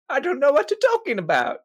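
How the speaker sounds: high, loud put-on voice, playing dumb; innocent denial. NPC denies his fake mustache, in a high innocent voice. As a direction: feign total innocence in a high voice.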